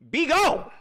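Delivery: high, forceful cry casting out a problem; commanding rebuke. Shown as a demonstration. Streamer shows how to tell a problem to go, commanding rebuke. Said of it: "That's how we be telling".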